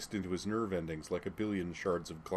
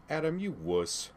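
slow, higher teasing voice; jokingly; 9 semitones higher than usual. A narrator's reading. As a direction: tease him playfully. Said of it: "Carrie said jokingly".

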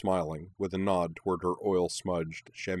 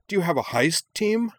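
high, loud, eager question; thrilled by the wild idea; mounting excitement; 11 semitones higher, 5 dB louder than usual. A narrator's reading. Minami explores the burglar idea, increasingly excited. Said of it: "getting more and more excited".

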